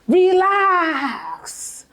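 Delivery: high, long drawn-out Relax; meme imitation. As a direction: mimic a meme with a stretched, high cry. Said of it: "Have you seen that meme?".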